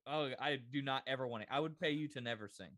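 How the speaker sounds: ordinary delivery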